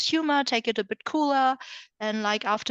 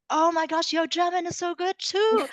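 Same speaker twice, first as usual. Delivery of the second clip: higher, teasing echo of the compliment; mock reply; 7 semitones higher than usual. She mimics her own cheeky reply to the stranger, higher and teasing. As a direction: toss the praise straight back, playfully. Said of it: "And I'm, I'm like".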